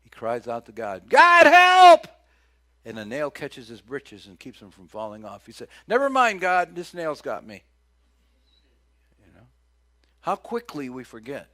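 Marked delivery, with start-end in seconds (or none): 1.1-2.1 very loud, high cry for help; man falling off the roof crying out to God; desperate cry. Pretend. 5.9-7.6 loud, high, quick brush-off; man caught on a nail calling off God; relieved dismissal. Pretend.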